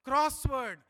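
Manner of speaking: Crossword said loud and high; stressed word. Shown as a demonstration. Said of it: "What is this crossword".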